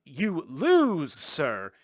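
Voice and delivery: loud, high Wonka-style yell; mock outburst. Wonka telling Charlie he has lost, loud mock fury. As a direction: shout it with stretched, swooping emphasis.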